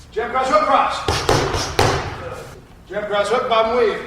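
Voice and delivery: loud, high combo calls from the pads; boxing coach calling combos. Shown as a demonstration. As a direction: bark each punch as it lands. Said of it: "let's put it together".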